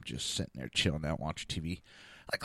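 ordinary talk

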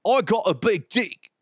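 high, dopey imitation of a show-off; hammed-up bragging voice; mock braggart. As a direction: mimic a boasting idiot. Mocks the contestant's bragging.

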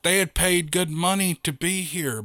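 ordinary delivery, nothing marked

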